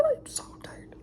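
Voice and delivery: breathy worn-out whisper; whisper, dead tired; exhausted whisper. Real, not acted. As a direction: barely voice it, spent.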